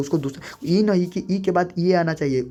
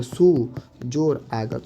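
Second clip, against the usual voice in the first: slow dictation; 3 semitones lower, much slower than usual. Shown as a demonstration. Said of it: "slow demo of the split".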